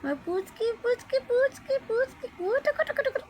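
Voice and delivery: very high-pitched pet cooing; tiny, high coochie-coo voice. Real, not acted. Tickling nonsense baby talk to pet.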